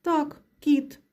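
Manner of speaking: ordinary delivery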